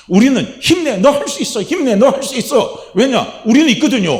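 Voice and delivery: ringing pulpit cry; fervent shout of encouragement. Acted. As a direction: urge yourself on at full preaching force.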